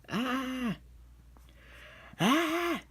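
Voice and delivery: high cartoon scream from up in the air; mock scream. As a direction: play-act a yell while flying through the air. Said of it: "'cause he's so high up in the air".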